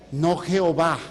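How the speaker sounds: ordinary delivery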